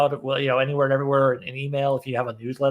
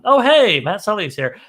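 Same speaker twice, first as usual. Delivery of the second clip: excited greeting; 6 semitones higher, 12 dB louder, slower than usual. Real, not acted. Greeting a guest joining.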